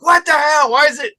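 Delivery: high, loud what the hell; angry outburst. Retold or imitated. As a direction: bark it out in a retold rage. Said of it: "I'm getting madder and madder".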